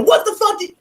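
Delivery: high-pitched imitation of hostile questioning; mock hostile. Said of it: "it's not like a hostile".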